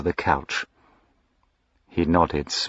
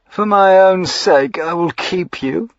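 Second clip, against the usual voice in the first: smiling triumph; 12 semitones higher, 7 dB louder than usual. A narrator's reading. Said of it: "he smiled".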